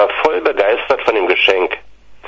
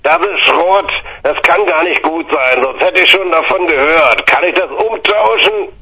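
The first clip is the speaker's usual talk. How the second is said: angry shouting; 7 semitones higher than usual. Retold or imitated. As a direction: shout him down, rising to the end. Uncle shouting in the argument.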